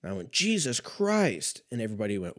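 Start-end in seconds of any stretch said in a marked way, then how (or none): 0.3-1.6 high, drawn-out mock holler without much volume; squeaky, slow mock exclamation; retold yell. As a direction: re-enact an excited outburst, stretched out. Re-enacting his Christmas yell.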